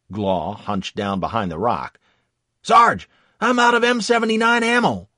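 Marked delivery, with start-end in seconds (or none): 2.6-5.1 loud, high urgent call; shouting. Acted. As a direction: yell to the sergeant. Soldier calls to sergeant.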